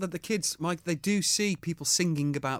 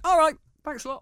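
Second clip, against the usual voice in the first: high mock child voice; squeaky imitation of a little boy; son's reply; 12 semitones higher, 5 dB louder than usual. Retelling the son's casual brush-off in a high mock voice.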